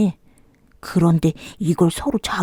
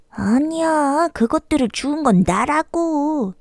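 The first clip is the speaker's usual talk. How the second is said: indignant monkey; 7 semitones higher, 4 dB quieter, slower than usual. Acted. Monkey protesting.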